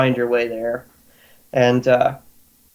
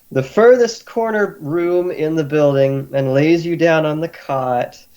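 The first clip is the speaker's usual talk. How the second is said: ordinary talk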